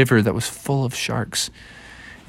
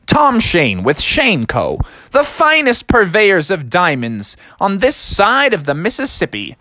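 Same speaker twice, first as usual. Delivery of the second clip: Tom Shane; 6 semitones higher than usual. Acted. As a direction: announce it like a slogan, savouring each word. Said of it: "He said".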